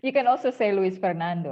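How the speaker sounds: amused laugh, then talk through it. Real, not acted. Amused by student's fumbled name. (bicara sambil tertawa geli)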